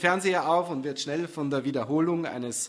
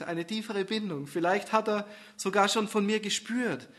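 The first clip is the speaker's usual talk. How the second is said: plain reading voice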